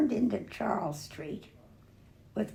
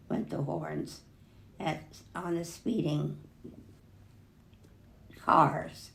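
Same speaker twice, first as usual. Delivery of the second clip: ordinary delivery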